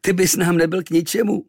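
lightly voiced mocking sailor. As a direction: sneer down at the boy. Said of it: "námořníci se rozchechtali".

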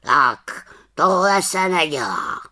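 chiding raven voice; reproachful. A narrator's reading. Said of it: "řekl káravě".

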